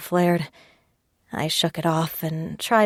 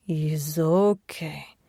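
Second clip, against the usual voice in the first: put-on gently calm her, accented; soothing Russian accent; much slower than usual. Sasha soothing her crying.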